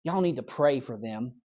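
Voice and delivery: soft, hushed mock-pious aside; pious gossip. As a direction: sneak gossip in as a prayer request. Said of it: "We attach something ... like this".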